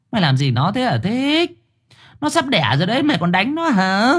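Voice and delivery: high-pitched indignant scolding; angry scolding mother. Acted. Mother accuses her son of hitting his pregnant wife, scolding. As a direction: tell him off, voice pitched high. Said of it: "Bà Thu chỉ mặt con trai".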